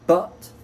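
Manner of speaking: but with a short vowel. Shown as a demonstration. Teacher giving a short vowel example, but with short U. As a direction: keep the vowel in but brief. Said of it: "c'est un U court".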